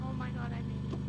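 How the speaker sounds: loud relieved cry; relieved shout. Real, not acted.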